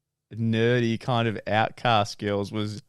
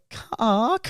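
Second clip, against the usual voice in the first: mocking jeer; 9 semitones higher than usual. Guys mocking the card.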